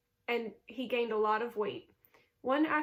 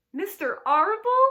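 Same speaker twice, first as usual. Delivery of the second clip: high, loud, tearful cry of disbelief; a young pig sobbing out the name; 9 semitones higher, 11 dB louder than usual. A narrator's reading. Piglet sobbing as he learns the farmer's plan. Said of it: "sobbed Wilbur".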